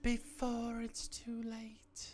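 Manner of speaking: whisper. Pretend. Echoing the game narrator.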